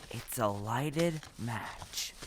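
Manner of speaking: slow and soft, dreading the word; a scarecrow's quiet dread; fearful confession. Acted. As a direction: hush it out slowly like a dark secret. Scarecrow confesses his fear.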